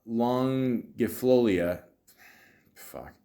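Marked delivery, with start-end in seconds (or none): none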